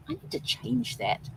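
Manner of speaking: slow, breathy whisper to herself; hushed inner voice; whispered thought. Retold or imitated. Inner thought while writing board.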